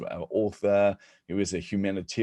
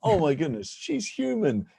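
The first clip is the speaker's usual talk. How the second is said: high, delighted mock glee; gleeful inner cheer; 12 semitones higher than usual. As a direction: squeal with secret relief. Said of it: "I was going".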